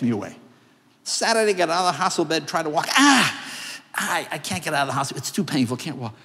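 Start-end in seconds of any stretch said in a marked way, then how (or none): 2.9-3.4 pain cry. Retold or imitated. Pain on trying to walk.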